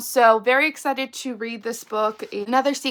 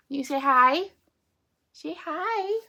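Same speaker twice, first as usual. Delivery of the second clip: high, rising coaxing voice; pet-talk voice; 7 semitones higher, slower than usual. Real, not acted. Coaxing a pet to greet viewers.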